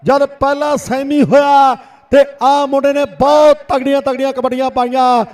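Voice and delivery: rapid village kabaddi play-by-play; frantic rural sports commentary. Real, not acted. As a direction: call every raid live to the crowd. Second commentator calls raids. (ਤੇਜ਼ ਪੇਂਡੂ ਖੇਡ ਕੁਮੈਂਟਰੀ)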